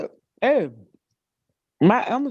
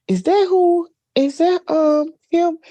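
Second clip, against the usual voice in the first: high, loud, excited mimicking of the niece; a touch of a nosy young girl; niece asking; 11 semitones higher, 5 dB louder than usual. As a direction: play the curious niece, eager to know who it is. Niece asks about the caller.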